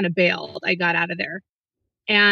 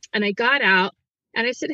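usual speaking voice

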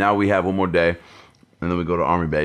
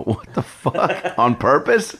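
surprised; 7 semitones higher, much slower than usual. Retold or imitated. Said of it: "I go".

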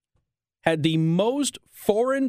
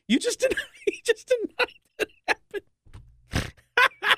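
laughing disbelief. Real, not acted. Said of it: "laughing in disbelief".

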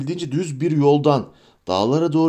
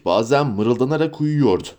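ordinary delivery, nothing marked

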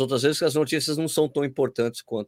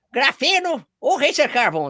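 high, slow put-on voice; funny voice; 11 semitones higher, 6 dB louder, slower than usual. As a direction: squeak it out in a silly voice. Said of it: "com essa voz?".

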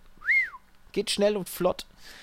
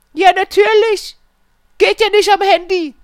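indignant customer; 13 semitones higher, 10 dB louder than usual. Retold or imitated. Customer insisting to the technician, high indignant voice. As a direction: act out her offended certainty.

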